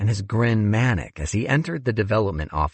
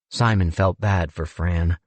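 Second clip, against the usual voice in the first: ordinary delivery, nothing marked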